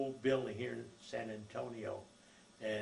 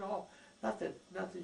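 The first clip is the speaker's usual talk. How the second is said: mother voiced; 8 semitones higher than usual. Mother voiced in retold story.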